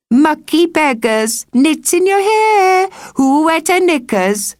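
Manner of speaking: high, loud, slow schoolyard taunt; jeering children. Acted. Local children taunt the evacuee girl, high and jeering. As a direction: jeer at her like a nasty kid.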